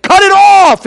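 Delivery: high, loud, drawn-out command; preaching shout. Retold or imitated. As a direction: re-enact the wound-up preacher's cry. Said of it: "I'm just preaching away".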